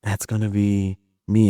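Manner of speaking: normal talking voice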